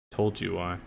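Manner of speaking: soft, muttered inner voice; a quiet little try; quiet trial line. Shown as a demonstration. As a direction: drop it low and quiet. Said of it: "I think that I told you why is better".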